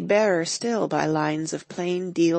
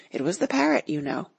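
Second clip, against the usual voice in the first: usual speaking voice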